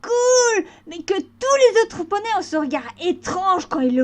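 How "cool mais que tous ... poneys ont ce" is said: put-on giddy young colt voice; excited colt. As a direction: gush about the hat like a thrilled kid. Button excited about hat.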